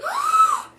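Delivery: very high startled gasp; sharp gasp. Acted. Reader gasping as Nutkin lands on the owl. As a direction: suck in air in shock. Nutkin jumps onto owl's head.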